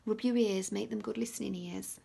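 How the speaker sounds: usual speaking voice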